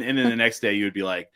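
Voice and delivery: ordinary talk, nothing marked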